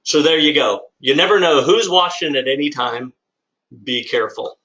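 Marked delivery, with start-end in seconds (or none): none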